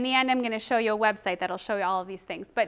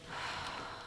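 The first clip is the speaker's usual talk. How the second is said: long audible exhale to calm down; deep calming breath; 15 dB quieter than usual. Shown as a demonstration. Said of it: "keep breathing".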